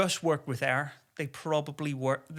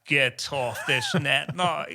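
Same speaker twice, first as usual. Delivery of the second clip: stern order; 6 dB louder, slower than usual. Retold or imitated. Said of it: "they'd be like".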